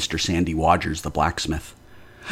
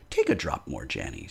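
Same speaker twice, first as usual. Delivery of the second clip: softer, coaxing murmur; calm her down gently; soothing; 5 semitones lower, 6 dB quieter than usual. Acted. Husband soothes fainting wife.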